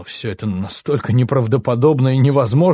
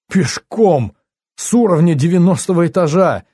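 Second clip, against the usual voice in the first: higher, incredulous outburst; acted dismay, voice raised in pitch; appalled exclamation; 6 semitones higher, 4 dB louder than usual. A narrator's reading. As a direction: repeat it in disbelief, pitch jumping up. Appalled repeat of the news.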